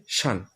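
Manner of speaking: শান with an open vowel, "shan", falling; new pronunciation. Shown as a demonstration. Teacher models the new ending, শান as falling "shan". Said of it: "তাহলে উচ্চারণ হবে".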